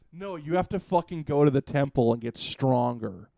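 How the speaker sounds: ordinary delivery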